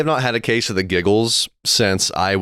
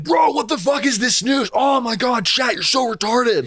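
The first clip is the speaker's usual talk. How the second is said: high shouting frat bro voice. Retold or imitated. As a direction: yell like a hyped-up frat bro streamer. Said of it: "you just talk like a frat bro".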